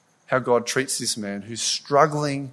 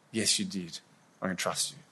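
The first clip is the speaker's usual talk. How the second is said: much softer, lower yielding answer; quiet surrender; 6 semitones lower, 14 dB quieter than usual. Retold or imitated. Preacher answering God's reminder, soft and lower. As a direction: murmur the reply almost to yourself.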